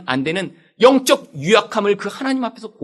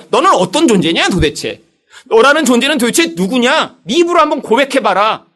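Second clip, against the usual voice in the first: louder, searching divine challenge; God's demand; 4 semitones higher, 6 dB louder than usual. Acted. God asking Jacob who he truly is, louder and commanding. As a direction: press him to confess who he is.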